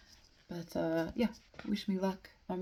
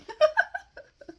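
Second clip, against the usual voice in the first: loud, high-pitched burst of giggles; real giggling fit; 11 dB louder than usual. Real, not acted. Reader cracking up over botched German, loud giggling. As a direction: lose it completely with high laughter. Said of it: "I'd be giggling this much".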